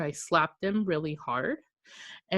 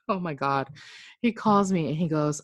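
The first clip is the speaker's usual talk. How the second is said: normal talking voice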